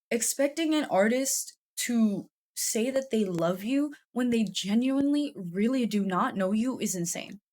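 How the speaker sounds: normal talking voice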